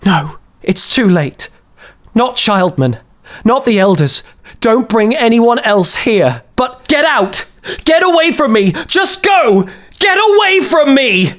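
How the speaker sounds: voiced woman, mumbling then shouting go; mumbled to rising command. A narrator's reading. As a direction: mumble it, then build to ordering him out. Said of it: "her mumbled words".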